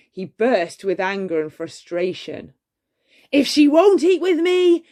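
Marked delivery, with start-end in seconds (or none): 3.3-4.8 higher and much louder, a beast's furious roar. A narrator's reading. Beast raging that Belle refused dinner, roaring. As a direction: bellow it out in a rage. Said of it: "he roared".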